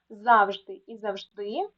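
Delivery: ЗАВЖДИ slowly, first ЗАвжди, then завжДИ; slow teacher's demo of Завжди і завжди, stress shifted; double stress. Shown as a demonstration. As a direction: show both stresses of Завжди, first syllable then last. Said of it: "слова з подвійним наголосом".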